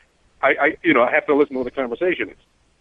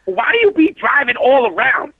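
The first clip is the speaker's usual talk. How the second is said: high, slow mock street voice for the woman passenger; streetwise woman; 11 semitones higher than usual. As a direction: imitate her sudden street attitude, high and drawn out. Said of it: "she went street on me".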